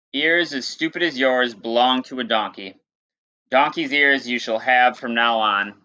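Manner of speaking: lightly dramatic read-aloud voice; storyteller reading to students; story narration. Acted. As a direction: tell the myth to the class. Teacher reads myth aloud.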